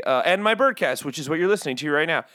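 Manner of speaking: usual speaking voice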